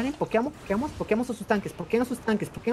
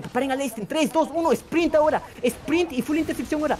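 urgent sprint call; 9 semitones higher than usual. Real, not acted. Shotcaller calling the sprint.

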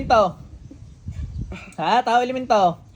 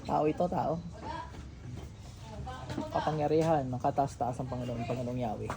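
low, much softer invocation; hushed prayer; 8 semitones lower, 13 dB quieter than usual. Acted. Healer invoking God over the spirit, hushed low prayer. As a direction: pray it almost inaudibly.

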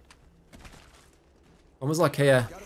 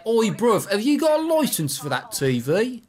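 exaggerated British accent, higher and drawn out; 7 semitones higher, slower than usual. Shown as a demonstration. Teammate demonstrating a line for a friend to copy, mock British accent. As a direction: put on a cheeky British street accent. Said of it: "Is that your British accent?".

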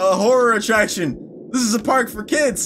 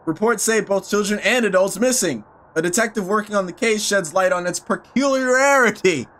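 lower, measured newscaster read; news report reading; 4 semitones lower than usual. Acted. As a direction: read it like a grave news bulletin. Reads newspaper article aloud.

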